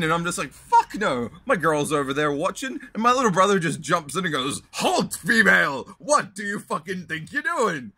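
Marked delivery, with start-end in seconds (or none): 0.5-2.8 loud, high retold protest; indignant refusal. Retold refusal of grabbing woman. 4.7-7.9 mock-heroic shout. Little brother jumps in to intervene.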